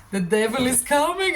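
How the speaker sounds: mock spooky warning; spooky announcer. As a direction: ham up the devil's arrival. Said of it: "Sorry, I had to say that".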